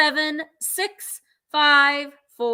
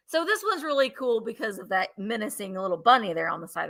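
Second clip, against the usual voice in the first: ordinary delivery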